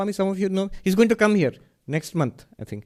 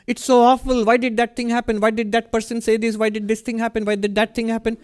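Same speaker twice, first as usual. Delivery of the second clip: higher, louder, fretful complaining; imitating a distressed listener, pitched up; mock upset complaint; 8 semitones higher, 3 dB louder than usual. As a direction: play a seeker wringing their hands over the world.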